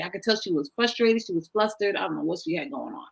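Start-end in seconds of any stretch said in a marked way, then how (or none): none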